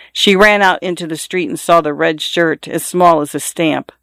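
usual speaking voice

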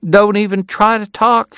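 high-pitched fussing Southern lady; crying out in concern. A narrator's reading. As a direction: exclaim it high, full of motherly alarm. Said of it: "she cried".

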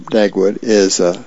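ordinary delivery, nothing marked

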